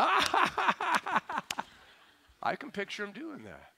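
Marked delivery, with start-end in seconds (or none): 0.0-1.6 high hammed-up belly laugh, much higher and louder; Jesus laughing. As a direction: act out a knee-slapping laugh, way up high. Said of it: "I think Jesus going".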